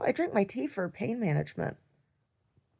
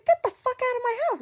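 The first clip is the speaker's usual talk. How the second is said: high, pitched-up yell; retold scream. Dreamer yelling at people in her home, high and shrill-pitched. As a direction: order them out with a high screaming voice. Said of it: "That's what I'm screaming".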